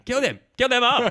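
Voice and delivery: high, loud mock battle cry; hammed-up shout imitating terrible acting; bad-acting yell. Mocking Rico's order as terrible acting, high hammed-up yell. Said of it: "one of the worst bits of acting".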